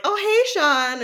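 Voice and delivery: high, loud mock hello; casual girl's greeting. Some girl walks by and greets Sean, imitated casual greeting. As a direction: call out a bright passing greeting, imitated.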